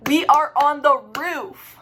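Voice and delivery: loud, slow, every word stressed; retold dismay. Told to take the stairs from a rooftop bar, dismayed. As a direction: protest it word by word, loudly and slowly.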